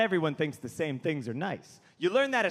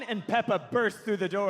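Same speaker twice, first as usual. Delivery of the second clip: ordinary delivery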